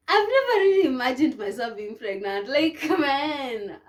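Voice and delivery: high, loud incredulous exclamation with a laugh; amused disbelief. Real, not acted. Mother recalling her first scan, amused disbelief.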